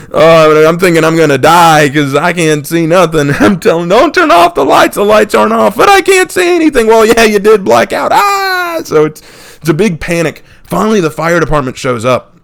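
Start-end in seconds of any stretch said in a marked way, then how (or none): 3.9-8.1 put-on high, loud panicky kid; panicked. Injured kid panicking, blinded. 8.1-8.8 pained cry. Retold or imitated. Kid's pain cry.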